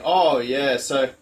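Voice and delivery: loud, high mock Dutch accent; a deliberately terrible Dutch impression; bad Dutch accent. Host quoting Rex about stones, loud bad Dutch accent. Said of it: "this is my worst ever Dutch accent".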